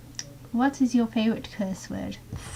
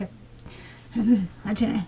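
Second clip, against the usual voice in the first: ordinary delivery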